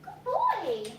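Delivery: high, bright praise for a pet. Real, not acted. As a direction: praise the dog.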